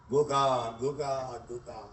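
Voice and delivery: tender devotion. Acted.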